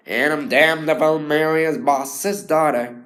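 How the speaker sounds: the American accent, jaw working; New England American accent. Shown as a demonstration. Student showing Billy's line in a New England American accent. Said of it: "using that real consonant that a lot of Americans".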